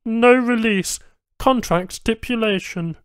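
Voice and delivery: high, loud, terse Banu refusal; clipped technician reply; curt alien tech. A narrator's reading. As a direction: shut him down by the rules.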